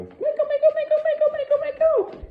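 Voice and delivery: very high, loud excited squealing. Shown as a demonstration. Trainer proofing the dog's down, high-pitched squeal. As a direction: squeal the name over and over to tempt the dog. Testing stay with excited calls.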